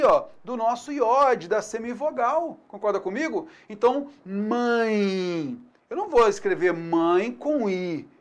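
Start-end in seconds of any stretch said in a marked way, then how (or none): 4.2-5.6 mãe drawn out very slowly so the nasal I glide is audible; slow teacher demo of mãe; slow model word. Shown as a demonstration. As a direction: stretch mãe into mã-ẽi. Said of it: "O som é o som aqui".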